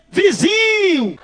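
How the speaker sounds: high, long drawn-out greeting call; cheerful calling out. Acted. Fattened pastor greets his rival up close, cheerful calling out. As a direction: stretch the word out in a high, hailing voice.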